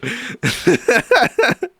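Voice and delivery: high, real laughing fit; helpless high laugh. Real, not acted. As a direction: burst out laughing at the absurdity. Laughing at the denial.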